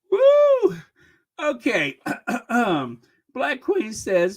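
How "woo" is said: loud, high "woo" after laughing; high whoop. Real, not acted. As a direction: let out a high whoop to recover. Recovers from laughing fit.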